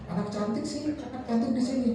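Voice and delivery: put-on father praising his girl, high and soft; doting praise. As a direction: coo at a little daughter. Father praising daughter after school.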